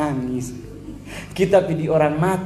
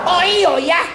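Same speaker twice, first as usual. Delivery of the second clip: put-on high loud Manado accent blurt; Manado accent slip; 13 semitones higher, 5 dB louder, slower than usual. Pastor mimicking an actor slipping into Manado accent, high and loud. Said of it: "Langsung dapat tahu kasih orang minsel".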